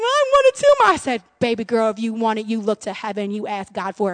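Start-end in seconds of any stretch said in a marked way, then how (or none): 0.0-0.9 high imitated child's plea; crying little girl. Daughter pleading to her mom, imitated crying. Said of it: "she's just crying". 1.4-4.1 low, soft motherly voice; tender reassurance. Retold or imitated. Mother to crying seven-year-old daughter, tender reassurance.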